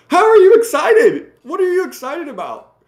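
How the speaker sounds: high, loud disbelieving challenge; incredulous retort. Retold or imitated. Vlogger challenges the excited bears, high incredulous voice. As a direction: scoff at their excitement. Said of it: "I'm like".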